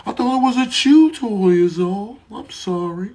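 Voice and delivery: high-pitched mock apology; apologetic shoe chewer. Caught chewer apologizing to the shoe's owner, apologetic. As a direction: plead innocence in a high voice.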